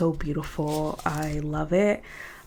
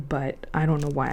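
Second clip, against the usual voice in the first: usual speaking voice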